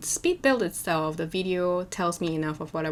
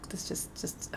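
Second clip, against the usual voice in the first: breathy whispered complaint; whispering; 12 dB quieter than usual. Real, not acted. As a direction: whisper it, voice almost gone. Complaining about sore voice.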